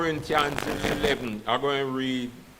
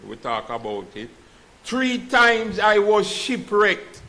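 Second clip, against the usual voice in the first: preaching at full force; 7 semitones higher, 4 dB louder than usual. Acted. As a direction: proclaim each hardship like a sermon climax. Reads Paul's list of sufferings.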